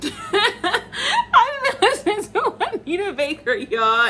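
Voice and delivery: laughing it out, slow and high. Real, not acted. Vlogger laughing as she admits her old-school taste. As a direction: giggle through the confession.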